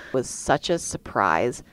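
ordinary delivery

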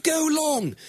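high, drawn-out "go long" holler; playful high-pitched holler; mock calling out. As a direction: call it high, as if down a field.

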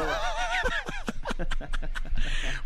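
high burst of laughter. Real, not acted. Big laugh after joke.

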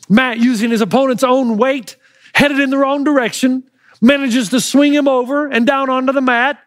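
ordinary delivery

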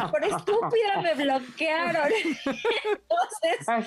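laughing through the words, drawn out. Real, not acted. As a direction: she can't stop laughing at the insult.

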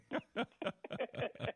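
high-pitched burst of laughter. Real, not acted. Host laughing after caller calls him Solomon, amused laugh. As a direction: laugh at a flattering compliment.

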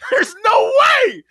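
high, loud, drawn-out disbelief; exasperated shout. Real, not acted. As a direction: cry it out in laughing disbelief, stretching the words. Exclaims disbelief at the imagined scene.

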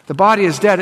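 ordinary talk

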